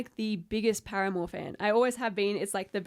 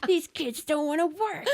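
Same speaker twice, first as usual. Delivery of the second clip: high, put-on grumbling elder; mock the older generation; mock grumpy elder; 7 semitones higher than usual. Mock old grump remark.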